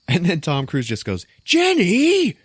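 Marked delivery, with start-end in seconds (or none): 1.4-2.4 very high, loud comic call; hammed-up shout. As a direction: yell the name in mock alarm. Tom Cruise calls out, retold.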